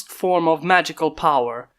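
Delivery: ordinary delivery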